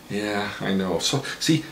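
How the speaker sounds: ordinary talk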